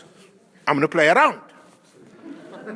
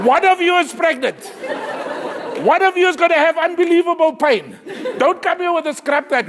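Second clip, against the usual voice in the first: mock shout; 8 semitones higher, 4 dB louder than usual. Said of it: "comic rant".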